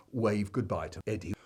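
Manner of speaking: plain reading voice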